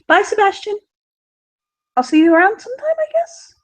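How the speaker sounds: high, lilting goodbye trailing up; shy girly goodbye. Acted. Daughter saying goodbye to her rescuer, high and shy. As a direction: wave him off a little bashful.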